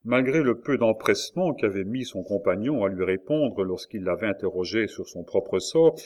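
plain reading voice

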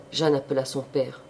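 usual speaking voice

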